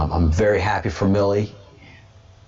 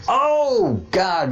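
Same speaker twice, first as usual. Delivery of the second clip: sudden oh; 11 semitones higher than usual. Real, not acted. Sudden remembering exclamation.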